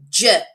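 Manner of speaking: J as a short, loud "juh"; J sounded "juh", not named; letter sound. Shown as a demonstration. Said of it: "you can say the sound of the first letter only".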